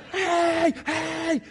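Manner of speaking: high, loud playful shout, laughing; mock shout. Fan shouting at a friend at a Padres game, high laughing shout. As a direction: call out to a friend at bat. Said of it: "I remember shouting at a friend".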